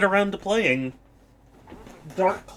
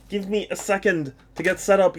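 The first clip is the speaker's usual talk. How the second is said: usual speaking voice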